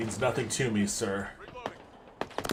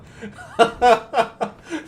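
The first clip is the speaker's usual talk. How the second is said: big delighted cackle; loud high laugh; 11 semitones higher, 7 dB louder than usual. Real, not acted. Laughs after winning fight.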